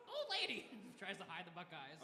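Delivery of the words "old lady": high squawky bird voice; kenku voice. Acted. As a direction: squawk it out like a parrot. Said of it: "He looks at you".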